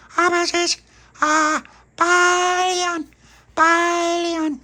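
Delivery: put-on high, loud, slow squeaky baby voice. Baby Lucia jumping on, high slow baby voice. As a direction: drag out billion like a tiny child.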